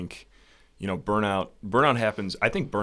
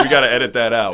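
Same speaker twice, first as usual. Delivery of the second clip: laughing, a bit louder, at his own slip; 3 semitones higher, 9 dB louder, faster than usual. Real, not acted. To the hosts about his slip, laughing. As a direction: laugh it off as he asks for the cut. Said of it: "should've said, should've said that".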